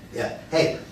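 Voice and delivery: ordinary talk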